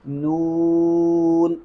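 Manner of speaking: "نون" held very long on a level note, n only at the end; slow, sustained "نون" kept out of the nose; correct noon. Shown as a demonstration. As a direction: stretch a pure oo in "نون" before closing. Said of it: "بلکہ ہم کیا پڑھیں گے".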